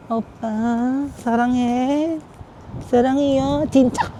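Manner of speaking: soft, high, drawn-out cutesy Korean; playful Korean phrases. Pretend. Vlogger playing at Korean on the street, cutesy. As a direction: coo Korean endearments in mock K-drama style.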